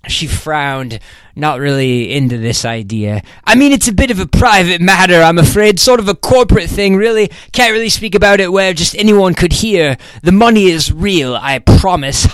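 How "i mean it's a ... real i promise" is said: higher, eager but furtive; furtive British rep. A narrator's reading. Rep asking to come in, furtive. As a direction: introduce yourself a bit shiftily.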